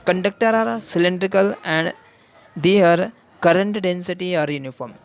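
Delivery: very slow, each word apart for note-taking; slow dictation. Shown as a demonstration. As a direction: dictate it so students can write. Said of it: "dictating assumption to students". (बहुत धीमे लिखवाते हुए)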